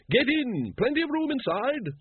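high, ringing aristocratic command; imperious lady. A narrator's reading. Lady Ramkin ordering the men into her coach, high and brisk. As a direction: hustle them in with a high-pitched order.

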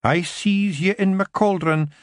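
slow, high Scots pixie, mystic; a wise woman reading her cauldron; seeing Kelda. Acted.

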